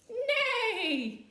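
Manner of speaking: a loud high whinny; horse neigh. Shown as a demonstration. Letting the children guess the horse, loud high neigh. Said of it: "It's a horse".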